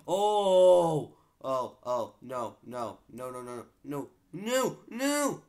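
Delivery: high shrieking no, no, no; hit hard crying out. Real, not acted. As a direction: cry out in panic as you're hit. I got hit, I got hit.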